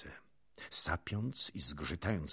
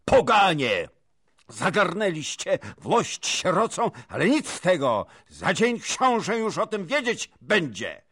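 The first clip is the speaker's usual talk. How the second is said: high, loud, outraged calling out; full-voiced furious warning; shouted denunciation; 14 semitones higher, 13 dB louder than usual. A narrator's reading. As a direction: hurl the threat at the whole room. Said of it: "Namiestnik zaś wołał".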